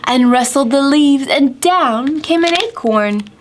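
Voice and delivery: sing-song rhyme with gasping wind effects; counting rhyme for kids. Acted. Teacher reading a counting rhyme to children, sing-song. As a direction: read it like a children's counting game.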